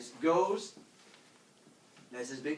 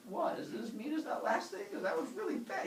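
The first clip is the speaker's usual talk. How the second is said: high, soft whine of hurt humans; mock tearful; 12 semitones higher, 3 dB quieter than usual. Hurt humans doubt the god, high mock whine. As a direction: whimper it, pretending to be upset. Crying humans answer warily.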